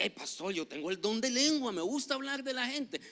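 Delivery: lightly voiced gossip, pitch climbing; mock churchgoer. Pastor mimicking a member who likes talking about people, lightly mocking.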